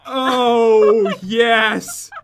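drawn-out, very slow words; slow motion. Pretend. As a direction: stretch every word like slow motion. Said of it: "I didn't mean for you to talk in slow motion".

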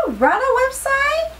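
"Rada website" squeaked high and slow; high excited squeak on "website"; high squealed question. Shown as a demonstration. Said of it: "I hope I'm pronouncing it right".

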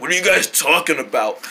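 ordinary delivery

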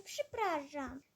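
low, soft, slow sorry; grudging apology. Acted. Sister apologizes low, soft and slow. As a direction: mumble a sorry you don't mean.

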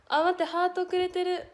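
高く大きな声で興奮して; 贈り物に気づいて声が弾む; うれしい驚き. Real, not acted. ハートが届いて驚き喜ぶ. (興奮した高い声)

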